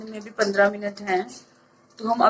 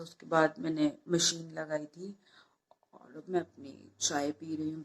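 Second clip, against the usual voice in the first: soft morning voice while everyone sleeps; hushed, not to wake anyone; 2 semitones lower, 7 dB quieter than usual. Real, not acted. Early morning vlog, family asleep, speaking softly. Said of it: "क्योंकि सब लोग सो रहे हैं".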